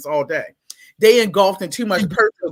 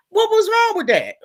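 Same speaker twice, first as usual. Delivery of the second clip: high-pitched indignant question; what did I do wrong; baffled protest; 12 semitones higher than usual. Acted. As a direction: pitch shoots up in disbelief. Protests being scolded for no reason.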